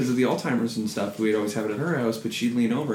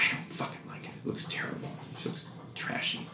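grandma's secret whispered gripe; conspiratorial whisper; 15 dB quieter than usual. Retold or imitated. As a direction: whisper it very softly, for one listener only. Grandma confiding nasty opinion secretly.

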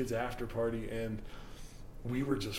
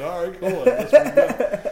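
laughing; 9 semitones higher, 13 dB louder, slower than usual. Retold or imitated. Quoting himself, laughing.

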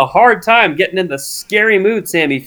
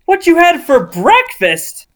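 slow, rising disbelief; drawn out, climbing higher with disbelief; incredulous; 8 semitones higher, slower than usual. Acted. Mocking read of journal.

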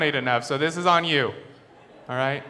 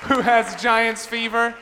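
slow, high, loud hype; mock hype; 8 semitones higher, 4 dB louder, much slower than usual. Real, not acted. Comic rallying the audience, slow hammed-up hype. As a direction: stretch it out like a cheesy emcee. Hyping the crowd, drawn out.

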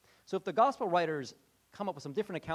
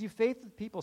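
louder, higher rebuke; mild rebuke; 6 semitones higher, 6 dB louder than usual. Acted. Jesus rebuking the crowd, raised scolding voice. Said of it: "He says".

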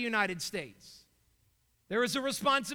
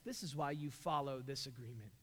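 much softer and lower, slowing on I am the Lord; quiet and grave; hushed voice of God; 10 semitones lower, 12 dB quieter than usual. Acted. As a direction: draw them in with a low, grave hush. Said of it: "And he says".

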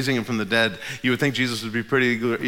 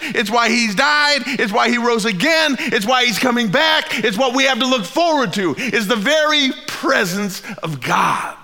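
louder, higher, slow insistent repetitions; rising preaching climax; 11 semitones higher, 5 dB louder than usual. Acted. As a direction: drive each line home at full force.

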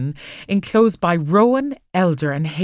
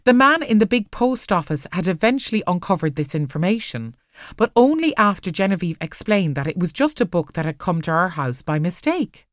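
ordinary delivery, nothing marked

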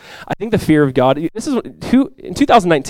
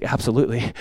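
dad's answer; 4 semitones lower, 8 dB quieter than usual. Retold or imitated. Dad calmly answers frightened child.

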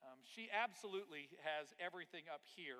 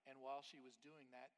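ordinary talk

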